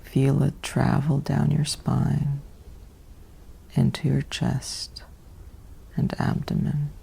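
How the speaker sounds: ordinary delivery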